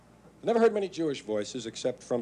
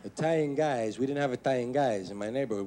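normal talking voice

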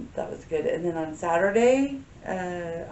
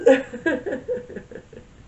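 giggle; 8 semitones higher, 6 dB louder than usual. Real, not acted. Laughs at own yawn.

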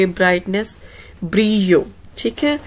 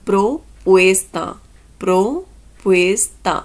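propuesta said very slowly; slow teaching demo; much slower than usual. Shown as a demonstration. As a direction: stretch propuesta for learners. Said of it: "next ਆਪਣਾ word ਹੈ proposal". (propuesta ਨੂੰ ਬਹੁਤ ਹੌਲੀ ਬੋਲੋ)

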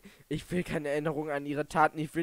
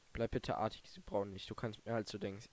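teary reread; 5 semitones lower, 7 dB quieter, faster than usual. Real, not acted. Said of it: "die Augen voller Tränen habe".

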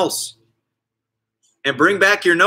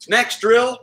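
excited coach call; 8 semitones higher than usual. Real, not acted. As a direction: pump up the class. Coach announcing new drill.

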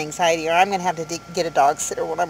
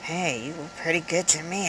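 soft, creepy come-on; much quieter put-on voice of a creep; weird voice; 2 semitones lower, 10 dB quieter than usual. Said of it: "he said it in a weird voice".

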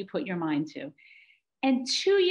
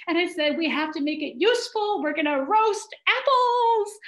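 put-on higher-pitched excited commands to the kids; barking orders; 6 semitones higher than usual. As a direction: rattle off jobs like a camp boss. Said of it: "I start barking orders at my kids".

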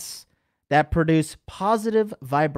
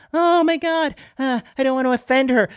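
mock timid guy; 8 semitones higher, 4 dB louder than usual. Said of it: "You tiptoe around".

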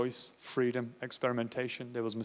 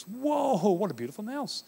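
mock amazed mum; 8 semitones higher than usual. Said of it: "she thinks".

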